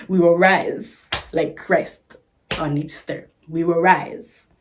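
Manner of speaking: slow, loud preacher proclamation; mock preaching. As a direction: proclaim it slowly like a sermon.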